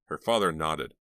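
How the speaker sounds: ordinary delivery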